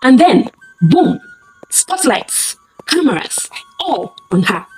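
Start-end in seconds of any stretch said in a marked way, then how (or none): none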